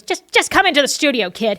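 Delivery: imitated annoyed boss. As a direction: give in, fed up with a pest. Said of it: "Jerry got so annoyed with him".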